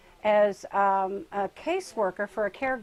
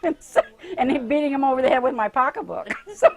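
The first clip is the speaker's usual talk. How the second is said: louder and higher, laughing through the story; 4 semitones higher, 10 dB louder than usual. Real, not acted. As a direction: laugh at the imagined fight. Joking about beating a robber.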